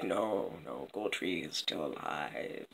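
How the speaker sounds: very low, hushed fish voice; fish's reply. Acted. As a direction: murmur it deep and barely audible. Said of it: "And the fish is like".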